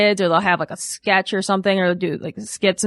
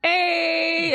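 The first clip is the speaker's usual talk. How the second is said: high sudden holler; mock pastor shout; 9 semitones higher than usual. Pastor cutting into another song, mock shout. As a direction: belt it like a pastor launching a song. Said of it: "he'll be like".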